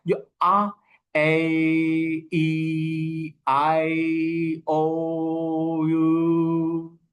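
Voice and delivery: आ, ए, ई, आई, ओ, यू held out and half sung; drawn-out vowel sounds. Shown as a demonstration. Teacher listing the vowel sounds for students, sung out. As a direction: hold each vowel "आई" and "ओ" long and sung. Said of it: "तो vowel sound क्या होती है?".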